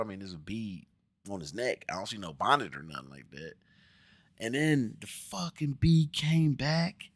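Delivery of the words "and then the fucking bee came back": mock dread. Real, not acted. Exasperated aside, slowed.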